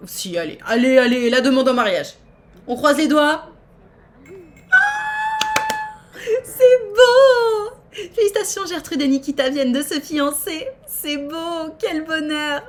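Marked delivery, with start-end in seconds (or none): 0.7-3.5 loud, eager cheering on; root for them out loud; loud hopeful cheer; excited cheering. Real, not acted. Cheering the proposal on. 4.7-12.6 mock tearful joy. Mock tears at Sims engagement.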